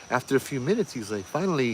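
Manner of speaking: usual speaking voice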